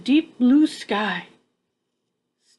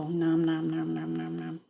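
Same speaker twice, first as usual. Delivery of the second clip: low, soft nom nom chewing; munching noises; 7 semitones lower, 8 dB quieter than usual. Acted. Reader voicing a bat munching mango, soft chewing noises. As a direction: chomp happily on a mango.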